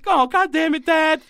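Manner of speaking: high-pitched mock exasperation; exasperated outburst. Podcast host reacting to the dad's family line, exasperated. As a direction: voice jumps up in playful frustration.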